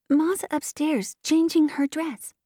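put-on high, slow little-girl voice; high singsong child. A narrator's reading. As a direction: pipe it up like a small child. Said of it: "said the freckle-faced little girl".

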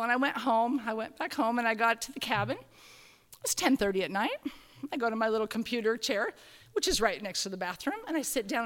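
ordinary delivery

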